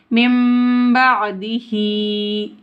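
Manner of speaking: मिन बादिही with n turned into a humming m; ghunna merging. Shown as a demonstration. Teacher showing mim joined to mim, humming मिन बादिही. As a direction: chant "mimm-ba'dihee" for मिन बादिही, slowly. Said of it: "मीम को मीम से मिलाकर पढ़ेंगे".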